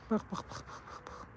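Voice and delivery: soft unvoiced repeated "bak"; whispered "bak bak". Real, not acted. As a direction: hush it so the cat isn't startled. Whispering at the cat. (fısıltıyla bak bak)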